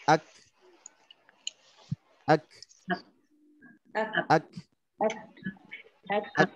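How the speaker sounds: Ak with the voice stopped but air flowing out; breathy stop. Shown as a demonstration. Teacher demonstrates sukun kaf with audible breath. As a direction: cut the voice, let breath run on. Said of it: "udaranya mengalir ... suaranya berhenti, tertahan".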